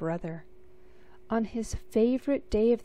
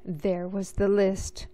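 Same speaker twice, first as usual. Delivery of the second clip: plain reading voice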